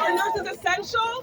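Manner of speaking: a shouted call to the crowd; rally call. Real, not acted. Rally speaker calls out to the nurses, shouting. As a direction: rouse the crowd with the question.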